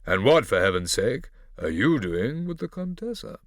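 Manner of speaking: haughty, loud imitation of a father; condescending Dottore Massimo. A narrator's reading. Scipio imitating his father to Barbarossa, condescending. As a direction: look down on him loudly. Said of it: "his father's condescending tone".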